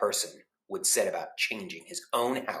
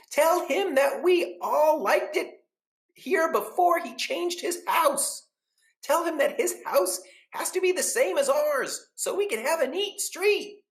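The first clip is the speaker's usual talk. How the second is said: gossiping neighbors; 6 dB louder than usual. Acted. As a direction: act shocked, drawing out each phrase. Neighbors instruct the man.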